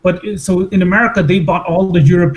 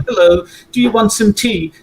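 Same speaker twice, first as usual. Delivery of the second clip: higher, lilting posh British accent; posh sing-song British; 4 semitones higher than usual. Shown as a demonstration. Showing how the upper classes were taught English, posh sing-song British. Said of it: "like a more sing-song".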